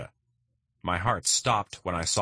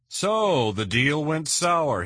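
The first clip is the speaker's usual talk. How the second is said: slow needling; 9 semitones higher, 5 dB louder, much slower than usual. Acted. Vargas probes McKnight on boat.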